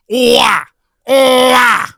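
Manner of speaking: loud high whooping animal call; animal cry. Pretend. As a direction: let out a silly animal whoop. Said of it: "an animal cry".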